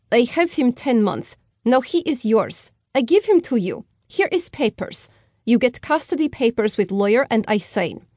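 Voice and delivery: a lightly voiced Slavic mother handing off the baby; Slavic accent. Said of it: "in a heavily accented voice".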